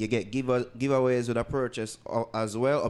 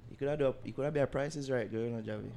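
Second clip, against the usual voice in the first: ordinary talk, nothing marked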